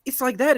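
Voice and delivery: a high mock screech; hint of a primal screech; imitating screech. Podcaster mimicking the streamer's primal screech. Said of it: "this primal screech".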